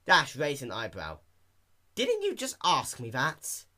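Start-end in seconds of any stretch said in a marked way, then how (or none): 2.0-3.6 slow, higher incredulous question; puzzled. A narrator's reading. Dash baffled by the repeated question, slow disbelief. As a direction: ask it in disbelief.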